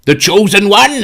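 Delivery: high, loud mock-grand announcement; gatekeeper voice. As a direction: proclaim it as the game's gatekeeper. Acting out the gatekeeper exchange.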